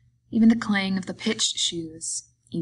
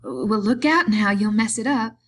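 raised, louder warning, short of anger; higher, urgent caution; alarmed warning; 4 semitones higher, 8 dB louder than usual. A narrator's reading. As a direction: warn him with rising annoyance. Wife warns as he strokes harder.

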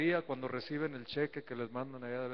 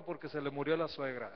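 ordinary delivery

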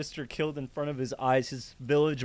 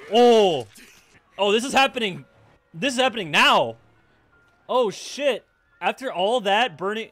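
loud, raised exclamations; shocked realization; 7 semitones higher, 9 dB louder than usual. Real, not acted. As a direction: cry out as it dawns on you. Shocked as the attack starts.

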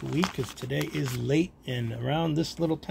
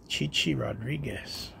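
ordinary talk, nothing marked

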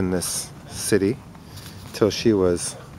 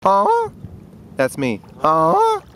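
cooing aw; 7 semitones higher, slower than usual. Real, not acted. Man cooing over the little ducklings, very high aw. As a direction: coo at the babies, way up high.

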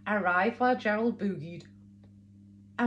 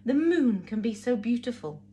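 usual speaking voice

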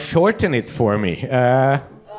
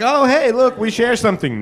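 mock buddy-buddy; 7 semitones higher than usual. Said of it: "It's like".

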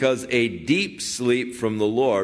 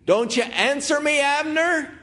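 high, loud call across the distance; yelling. Acted. David calling across the valley to Abner, loud high shout. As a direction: shout it out to be heard far away. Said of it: "David started crying out loud. He started yelling".

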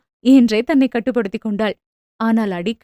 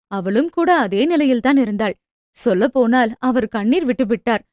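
plain reading voice

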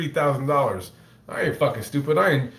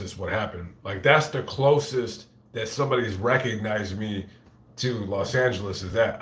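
usual speaking voice

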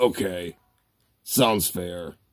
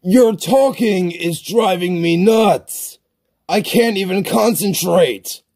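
frustrated gamer rage; raging; 8 semitones higher than usual. Acted. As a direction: lay out each word at the end of your patience, pitched up. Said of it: "Stop raging".

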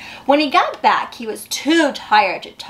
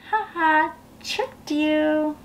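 sneaky tease; 3 semitones higher, 5 dB quieter, much slower than usual. Pretend. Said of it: "are you thinking that she's kinda like".

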